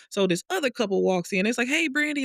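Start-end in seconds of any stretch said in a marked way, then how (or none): 1.7-2.2 much higher, sing-song 'Hey, Brandy'; cheery greeting. Retold or imitated. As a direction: greet her like an old friend. Couple greeting her cheerfully.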